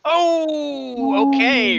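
high, loud startled oh; surprised. Real, not acted. GM surprised as the player rolls a natural twenty. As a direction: caught off guard by the big roll.

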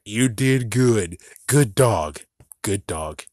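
normal talking voice

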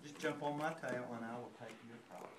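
low, soft, quick fox voice; sly fox. Acted. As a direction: coax him quietly like a cunning fox. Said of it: "The fox saw this and said".

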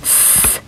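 "s" as an isolated hiss; "s" as the bare consonant, not the letter name; soft s sound. Shown as a demonstration. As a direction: sustain the soft "s" with no vowel. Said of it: "to a soft s sound".

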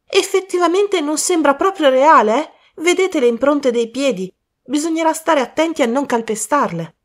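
a worried youth bursting in; young man Colin. Acted.

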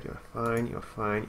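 ordinary talk, nothing marked

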